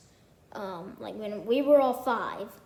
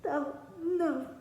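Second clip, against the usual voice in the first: mock moping; 5 semitones higher than usual. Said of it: "he was just in a corner".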